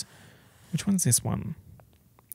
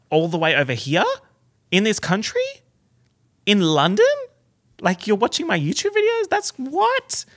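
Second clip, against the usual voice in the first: rising disbelief; 8 semitones higher, 4 dB louder than usual. Retold or imitated. Vlogger recalls fans abroad, disbelief climbing to a squeal. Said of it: "And I was like".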